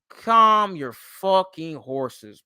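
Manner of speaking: slow, drawn-out exasperated plea; emphatic, word-by-word scolding; exasperated retort. Acted. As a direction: stretch out each word to rein him in. Retorting to Phenom's overblown claim.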